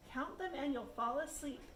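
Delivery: usual speaking voice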